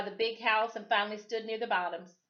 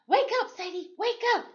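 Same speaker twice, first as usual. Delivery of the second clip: urgent mother's call; 14 semitones higher, 6 dB louder than usual. A narrator's reading. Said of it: "her mama whispered breathlessly".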